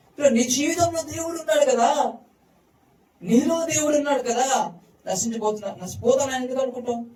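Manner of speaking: fervent preaching. Acted. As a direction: press the truth home to the congregation. Preacher presses the point.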